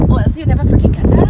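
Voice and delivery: loud, fast emphatic burst; emphatic outburst. Real, not acted. Vlogger pushing viewers out of their comfort zone, emphatic outburst. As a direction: punch it out loud and quick.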